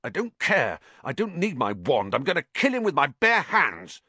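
high, straining rage; furious. A narrator's reading. Ron held back by friends, raging at Malfoy. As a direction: fight to get loose.

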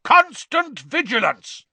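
loud, high, drawn-out roar. Acted. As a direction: roar it out slowly as a warning to the class. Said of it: "he roared".

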